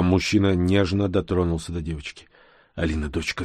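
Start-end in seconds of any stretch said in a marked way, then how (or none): 2.7-3.4 soft, low murmur; whispering to daughter. A narrator's reading. Father to his daughter, lightly whispered. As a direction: softly coax a child, understated. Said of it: "зашептал он".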